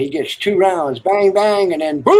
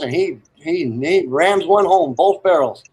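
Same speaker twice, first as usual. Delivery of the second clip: normal talking voice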